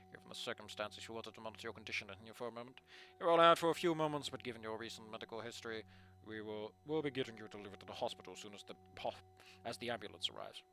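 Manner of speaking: low, soft official voice; a calm school official, voiced low and quiet; stern administrator. Voices Mister Farris character.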